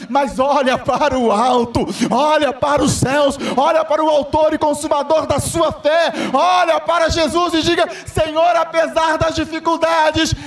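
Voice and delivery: preaching at full force. Acted. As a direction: fire up the church at the climax. Climactic sermon exhortation.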